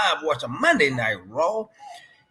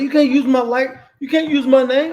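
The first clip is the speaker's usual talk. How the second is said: higher, louder mock complainer; mock objection; 6 semitones higher, 6 dB louder than usual. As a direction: put on an indignant objector's voice. Voicing imagined objectors to nickname.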